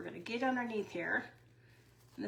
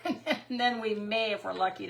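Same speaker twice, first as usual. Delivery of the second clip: usual speaking voice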